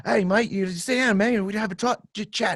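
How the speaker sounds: imitating a friendly Australian; Australian accent. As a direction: toss it off in a casual Australian accent. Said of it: "he had an Australian accent".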